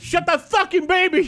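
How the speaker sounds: high, loud mock rant; angry passenger. Imitating the angry guy yelling about the crying baby. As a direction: yell it like an annoyed passenger. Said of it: "and he's like".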